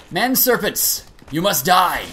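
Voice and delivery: loud mock war cry; battle cry. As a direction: yell it at the enemy.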